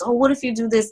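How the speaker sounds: mom making suggestions. Retold or imitated. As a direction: imitate mom pitching an idea, voice lifted. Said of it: "she's always, like, giving suggestions".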